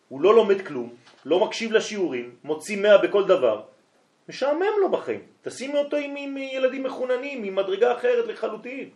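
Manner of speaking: high, loud, insistent rebuke; loud, high scolding of the mother over the school; heated scolding. Retold or imitated. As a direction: hammer it into the mother, voice way up. Scolding mother about wrong school.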